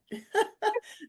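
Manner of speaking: high breathy laughing burst. Real, not acted. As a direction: laugh at the awkward moment. Laughing at the story.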